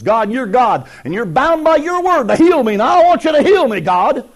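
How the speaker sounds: mock faith healer shouting at God; name it claim it demand. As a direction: insist God is bound to heal. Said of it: "claim it crowd says".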